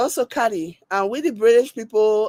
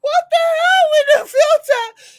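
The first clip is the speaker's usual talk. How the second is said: loud, very high squealed question; high exclaiming squeal; 6 dB louder than usual. Real, not acted. Reacting to the video's filter, high squeal. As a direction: squeal it in amused disbelief.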